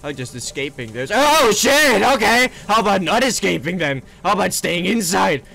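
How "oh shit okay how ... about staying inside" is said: loud, high yelp into hasty backtracking; alarmed. Real, not acted. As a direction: blurt it out loudly in a fright. Startled while escaping, backs off.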